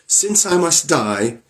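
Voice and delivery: high, slow, tearful plea; wife in tears pleading with Bluebeard. Acted. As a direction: beg him slowly through tears. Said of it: "her eyes all bathed in tears".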